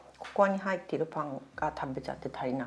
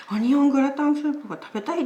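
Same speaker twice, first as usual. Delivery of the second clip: higher, louder eager craving; imitating a friend; 9 semitones higher, 7 dB louder than usual. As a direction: act out the excited friend. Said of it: "って言いながら来たよ".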